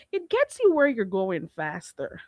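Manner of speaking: usual speaking voice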